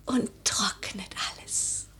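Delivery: fading to a whisper; faint whisper. Acted. Lady drifting in reverie, whispering. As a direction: let the thought die away on the breath.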